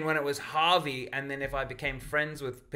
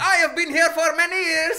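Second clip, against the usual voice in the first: high, loud mock Indian accent; 9 dB louder than usual. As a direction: shout it in a hammed-up Indian accent. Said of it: "hoping you were gonna do the voice".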